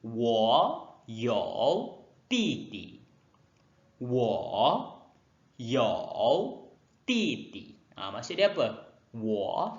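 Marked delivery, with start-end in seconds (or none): 0.0-3.0 我有弟弟 disebut sangat perlahan; ayat contoh perlahan. Shown as a demonstration. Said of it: "contoh ayat". 4.0-7.8 Wo, you, titi dengan jeda panjang antara perkataan; Wo ... you ... titi, setiap perkataan diasingkan; satu per satu. Shown as a demonstration. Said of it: "contoh ayat".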